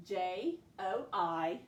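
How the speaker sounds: letters spelled out slowly; slow spelling. Shown as a demonstration. Teacher dictating letters to pupils, slow and clear. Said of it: "J that we spell with a".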